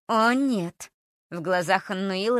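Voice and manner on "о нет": put-on sinister girl voice.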